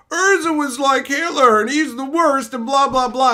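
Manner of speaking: high, loud mocking whine of a fan; mocking Reddit fan. Mimicking Reddit fans, high mocking whine. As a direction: sneer it like a whiny forum poster. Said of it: "people are crackheads and they're like".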